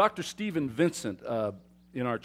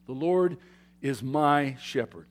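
ordinary delivery

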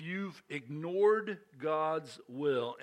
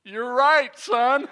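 amused cop; 8 semitones higher, 12 dB louder, much slower than usual. Retold or imitated. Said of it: "He's like".

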